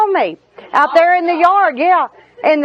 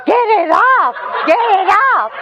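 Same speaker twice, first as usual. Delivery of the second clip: laughing exasperation; 7 semitones higher than usual. Retold or imitated. Wife retold, laughing at husband.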